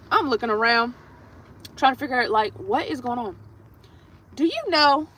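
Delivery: ordinary talk, nothing marked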